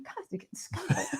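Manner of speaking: breathy whisper, laughing; whispered aside. Real, not acted. Guest reacting to her friend's grim advice, whispered. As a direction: whisper it in mock despair.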